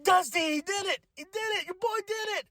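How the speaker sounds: very high, thrilled cry to the heavens; joyful calling out. Retold or imitated. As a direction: call up to someone far above, overjoyed. Retells calling up to the sky.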